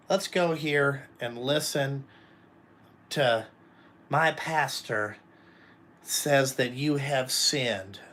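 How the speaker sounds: slow, measured mock-preachy delivery; mock pious churchgoer. Churchgoer urging someone to hear the pastor, mock pious.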